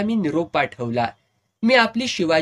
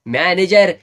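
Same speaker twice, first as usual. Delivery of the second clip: loud call across the stage for manager; drunken shout; 2 semitones higher, 9 dB louder than usual. Retold or imitated. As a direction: bellow "manager" to summon him. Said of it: "ओरडला".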